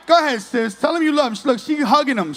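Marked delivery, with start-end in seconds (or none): none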